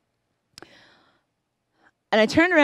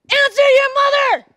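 much higher, much louder angry yell; husband's shout; 10 dB louder than usual. Retold or imitated. Husband yelling at the silent son in the car, furious shout. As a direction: bark it out like a fed-up dad. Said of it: "And he just goes".

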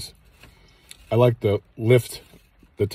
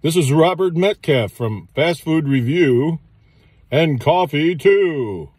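high, slow radio announcer voice; 7 semitones higher, 3 dB louder, slower than usual. Shown as a demonstration. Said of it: "How do you like that radio voice?".

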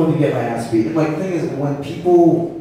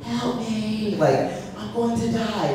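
high, small, slow cry for help; mock frightened plea; 8 semitones higher, 3 dB quieter, slower than usual. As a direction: beg your friends to save you, softly. Scared teller calls friends for help.

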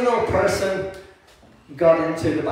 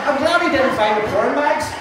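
high, cheeky line through laughter; laughing; 5 semitones higher than usual. Real, not acted. Preacher joking to the congregation while laughing. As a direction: toss the joke out while still laughing.

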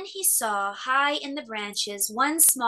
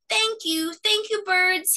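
high, breathless thank-you; gasped thanks; 10 semitones higher, 4 dB louder than usual. A narrator's reading. Cub gasps thanks to the birds. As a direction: gasp out grateful thanks. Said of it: "he gasped".

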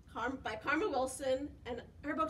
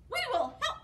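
higher, louder storybook hare; helpful hare; 9 semitones higher, 5 dB louder, much faster than usual. A narrator's reading. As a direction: pipe up eagerly as a hare. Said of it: "Hare says".

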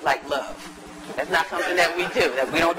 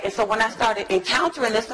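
normal talking voice

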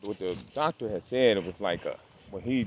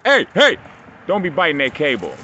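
much higher and louder hey hey, then firm; sharp warning shout; 6 semitones higher, 11 dB louder than usual. Real, not acted. Owner yelling at dog biting the cable, sharp shout. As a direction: yell to stop the dog chewing.

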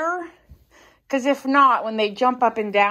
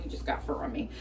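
low, whispered grumble; whispered aside; 9 semitones lower, 11 dB quieter, faster than usual. Real, not acted. Whispering to herself about the cat's fur on her hand. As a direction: mutter it under your breath.

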